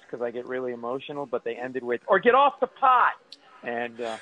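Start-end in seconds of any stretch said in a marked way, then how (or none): 2.1-3.1 higher, louder acted demand; exasperated ultimatum. Wife ending her proposal to him, exasperated and loud. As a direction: punch it out as a fed-up ultimatum.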